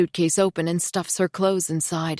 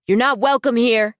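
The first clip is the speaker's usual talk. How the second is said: calling out; 6 semitones higher, 7 dB louder than usual. A narrator's reading. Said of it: "the cashier calls after her".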